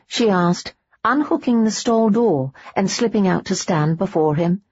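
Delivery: usual speaking voice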